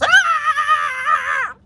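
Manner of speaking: high, sustained mock scream; long high-pitched shriek, imitated; child's scream. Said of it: "be screaming like he's in a haunted house".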